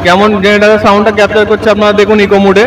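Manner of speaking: loud voice with echo effect; cathedral echo. Real, not acted. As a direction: speak through an echoing mic. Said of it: "এখন আমার sound আপনারা ইকো শুনতে পাচ্ছেন". (ইকো মোডে প্রতিধ্বনিত গলা)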